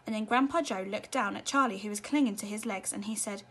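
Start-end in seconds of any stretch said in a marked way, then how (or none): none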